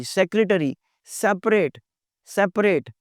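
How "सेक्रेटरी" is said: "सेक्रेटरी" as sek-re-ta-ree; correct "secretary". Shown as a demonstration. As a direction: give "सेक्रेटरी" all four syllables. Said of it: "सेक्रेटरी नहीं".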